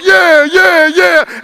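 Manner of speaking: loud, high swaggering yeah; confident yeah. Pretend. Believer walking around confident, loud high swagger. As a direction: strut it out loud. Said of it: "you walk around like".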